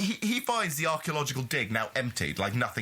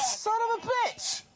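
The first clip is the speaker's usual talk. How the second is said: high exasperated cry; outraged shout. Real, not acted. Furious at kid in film.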